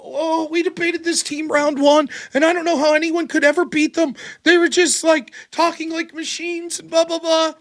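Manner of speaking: high-pitched imitation of a tearful complaint; put-on high wail, trailing into dismissive blahs; mock crying partner. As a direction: whine it high, like a distraught teammate. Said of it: "she's still crying, this fucking mess".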